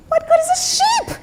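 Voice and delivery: can't believe the advice; incredulous Peter. Acted. Peter scoffs at the wise man, high and incredulous.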